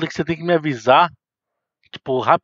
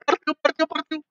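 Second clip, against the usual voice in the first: excited; 10 semitones higher, 3 dB louder, slower than usual. Real, not acted. Excited after escape.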